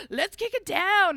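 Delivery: hammed-up eager shout; eager Will. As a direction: charge in like an overexcited bro. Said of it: "And he's like".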